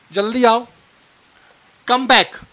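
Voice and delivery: usual speaking voice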